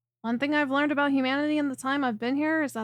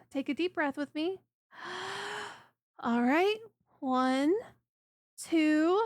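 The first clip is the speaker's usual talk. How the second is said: soothing guidance; 3 semitones higher, 4 dB quieter than usual. Acted. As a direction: gently coax them to breathe and relax. Guiding client through a constriction.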